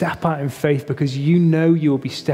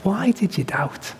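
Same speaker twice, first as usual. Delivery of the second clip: gentle Jesus; 8 semitones higher than usual. Retold or imitated. Preacher voicing Jesus tenderly to Peter, gentle chiding. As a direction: gently chide a friend who faltered. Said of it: "Jesus' tone of voice was like".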